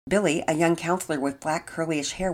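ordinary delivery, nothing marked

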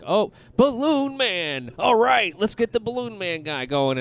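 high, slow mocking voice; mock writers' room. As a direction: roll your eyes at a lame villain pitch. Mocking lazy monster-of-week writers.

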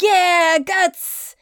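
high, excited group cheer; cheering crowd. Acted. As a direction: welcome a friend back with mock jubilation. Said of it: "everyone is like".

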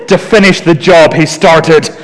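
normal talking voice

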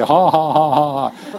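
mock congregation laughter, light ha ha; acting out a congregation's laugh. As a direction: play the crowd chuckling along. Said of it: "Suddenly the laughter stops".

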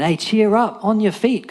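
high, rousing call; imitated calling out. Crowd calling to the blind man, urging. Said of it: "they called to the blind man".

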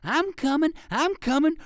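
very high, loud eager yelp; barking dog. A narrator's reading. Second dog answering the first, yelping high. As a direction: yelp it like a dog racing to catch up. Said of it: "barked the second dog".

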